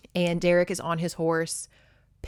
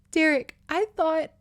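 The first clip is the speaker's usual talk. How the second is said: high, earnest character voice; emotional heroine's line; 10 semitones higher than usual. A narrator's reading. She looks up after the kiss, high earnest plea. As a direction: start a breathless confession, pitched up. Heroine speaks in story reading.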